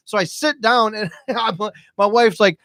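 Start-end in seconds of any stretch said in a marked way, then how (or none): none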